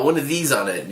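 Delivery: ordinary talk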